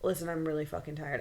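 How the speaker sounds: low, soft and rushed; mumble-quick imitation of a weary caller; tired retelling.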